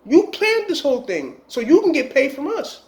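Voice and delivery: high, mock-angry accusation; hammed-up betrayed gunman; angry shooter. Gunman accusing the woman of planning the hit, high and mock-angry.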